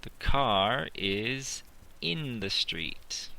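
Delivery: very slow, word by word; slow dictation. Shown as a demonstration. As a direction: dictate slowly for the class to write. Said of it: "Maybe I can say".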